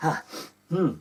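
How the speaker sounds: plain reading voice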